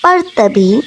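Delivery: usual speaking voice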